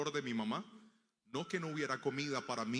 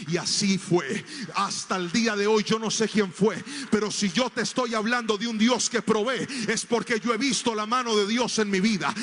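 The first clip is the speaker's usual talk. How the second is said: much louder, higher testimony; preaching at full force; 6 semitones higher, 11 dB louder than usual. Acted. Preacher to the congregation, loud and rising. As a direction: proclaim God's provision with full voice.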